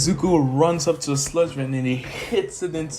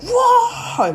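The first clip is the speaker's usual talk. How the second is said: much higher, louder shocked what; stunned crowd; 8 dB louder than usual. Retold or imitated. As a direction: gasp in disbelief. Everyone stunned by the finish.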